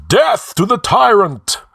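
loud, high-pitched war cry; battle yell. A narrator's reading. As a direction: yell it like a rebel storming the room. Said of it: "yelled Rinaldo".

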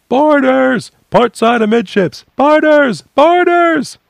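high, loud repeated alarm shouts; alarm cries. A narrator's reading. Sailors warning the crew of boarders, high loud cries. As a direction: bellow the warning so the whole ship hears. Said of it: "The cries echoed around them".

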